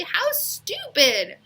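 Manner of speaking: high, slow, mocking scorn; scornful. Leslie mocking Calvin's tattoo, high and slow. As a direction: sneer it out.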